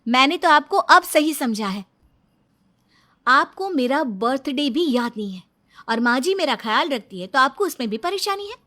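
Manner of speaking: loud, bitter wife's retort; loud taunting rebuke to her husband; angry retort. Acted. Wife confronts husband, then storms off.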